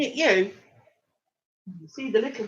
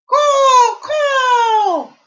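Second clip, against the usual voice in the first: crow caw; 13 semitones higher, 13 dB louder than usual. Pretend. Crow revealed in the picture.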